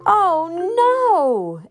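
alarmed cry. A narrator's reading. Narrator sees a buffalo stampede, high alarmed cry.

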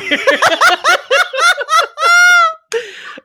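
helpless crying laughter, very high; high shrieking laugh. Real, not acted. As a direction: laugh until it hurts. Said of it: "my side hurts from laughing".

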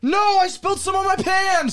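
high, alarmed outcry; surprised shout. Real, not acted. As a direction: yelp at the spill. Spills lemon juice, cries out.

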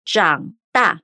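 长大 as zhǎng dà, falling-rising then falling tone; 长大 em mandarim, tons claros; Mandarin zhǎng dà. Shown as a demonstration. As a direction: say 长大 slowly with clear Mandarin tones. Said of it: "Mais uma vez".

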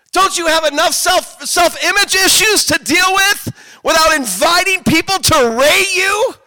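high, full-volume shouted rebuke; Deborah's impassioned rebuke. Acted. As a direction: yell it like rousing a sleeping crowd. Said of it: "Can you imagine Deborah going".

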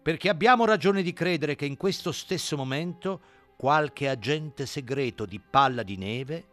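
solemn, weighty warning. Acted. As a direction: warn gravely, slowing near the end. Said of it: "aggiunse con solennità".

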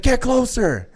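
high, loud command; imitating a yelling skydive instructor; yelled order. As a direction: shout it like an impatient instructor. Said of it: "The guy's yelling at me".

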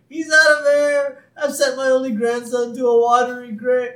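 high, shouted mock despair; hammed-up anguish. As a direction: wail loudly in theatrical horror. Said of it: "full range of emotions right there".

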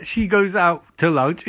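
ordinary talk, nothing marked

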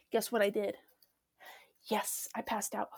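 hushed whisper. Real, not acted. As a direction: lean in and whisper. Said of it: "whispered aside".

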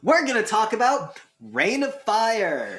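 slow, loud and high hammed-up host intro; mock host voice. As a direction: announce it like a show host. Mock announcing the topic.

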